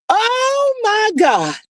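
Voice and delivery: high delighted oh my God; overjoyed gasp. Real, not acted. Reacting to the news for viewers, high delighted squeal.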